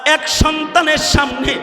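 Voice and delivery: high, chanted courtroom retelling at full force; impassioned preaching. Real, not acted. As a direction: cry it out loud and high, stretching phrase ends. Preacher dramatises court scene.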